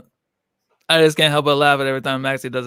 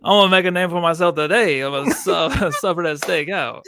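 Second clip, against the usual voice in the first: loud, higher hammed-up Cajun accent; Cajun Gambit accent; 3 semitones higher, 6 dB louder than usual. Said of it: "do it with the accent".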